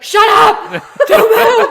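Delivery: very loud, high acted shriek; retold screaming. Strangers silencing her in danger, imitated screaming. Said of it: "start screaming at me".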